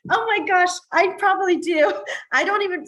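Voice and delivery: high, loud, laughing admission; real laughing exclamation; amused. Real, not acted. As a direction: burst out with it through laughter. Laughs at being caught out.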